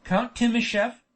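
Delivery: plain reading voice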